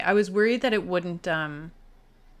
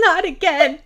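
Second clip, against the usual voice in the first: high, loud mock lament; crying out; 8 semitones higher, 9 dB louder than usual. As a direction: wail it in play grief. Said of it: "Are you crying?".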